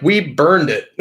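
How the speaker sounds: taunting with a laugh. Retold or imitated. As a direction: say it through a laugh. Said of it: "And they're like".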